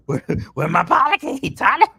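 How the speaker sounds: high, loud comic holler; mock demanding. Backer jokingly demands the Polycade from Tyler, mock-outraged holler. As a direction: holler it in playful outrage, climbing to the name.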